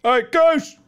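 high, loud call. Pretend. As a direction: holler it to get attention. Calling out in the game.

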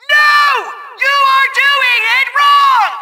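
high, furious scolding; slow, high-pitched angry shout; exasperated yelling. Acted. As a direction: explode at a bungling minion. Villain yells at player's mistake.

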